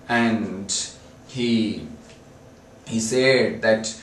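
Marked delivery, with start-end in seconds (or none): none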